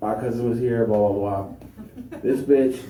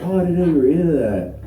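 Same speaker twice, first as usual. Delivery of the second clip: higher, louder, quick mock surprise; acted surprise; 8 semitones higher, 6 dB louder, faster than usual. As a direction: play a partner's startled discovery, fast and high. Imagined partner reacts with surprise.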